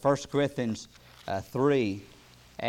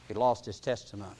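ordinary talk

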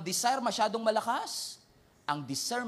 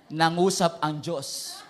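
solemn slow declaration; 2 semitones lower, 3 dB louder, much slower than usual. Retold or imitated. Suitor claims God spoke.

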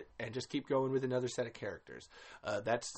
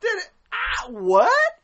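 shocked outburst; 14 semitones higher, 13 dB louder, much slower than usual. Real, not acted. Shocked at the movie scene.

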